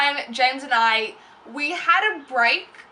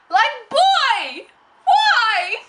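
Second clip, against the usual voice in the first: high, loud mock-indignant cry; exasperated outburst; 9 semitones higher, 5 dB louder, much slower than usual. Vlogger reacts to ex's snub, in an exasperated cry. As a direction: cry it out high in exaggerated disbelief. Exclaiming at ex's retold reply.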